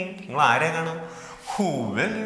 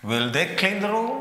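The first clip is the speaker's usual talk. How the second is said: steady climb up to "room"; rising intonation. Shown as a demonstration. Teacher repeating the model question for the class, rising intonation. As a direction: ask it with "room" as the highest point. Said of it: "Rising intonation".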